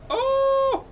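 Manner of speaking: sudden high shriek of shock, very loud; startled yelp. Real, not acted. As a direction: jump out of your skin.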